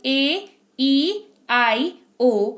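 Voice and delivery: slow, drawn-out vowel letters; vowel names recited. Shown as a demonstration. Teacher slowly naming the vowels for students. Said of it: "पांच होते हैं vowel".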